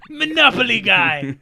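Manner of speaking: loud, high, drawn-out caricature; mock monocled gentleman. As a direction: boom it slowly like a hammed-up gent. Joking as monocled Monopoly gentleman.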